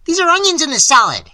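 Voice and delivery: high, loud outraged yell; furious high-pitched yell. A narrator's reading. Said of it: "he yelled".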